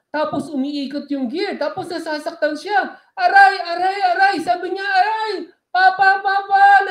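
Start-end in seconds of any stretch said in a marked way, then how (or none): 3.1-4.4 high, loud cries of pain; shrill pained ouch; child crying in pain. Acted. Child trapped in gear, crying out. 4.8-6.9 high, loud desperate call; trapped child yelling; child calling for help. Acted. Child calls father for help.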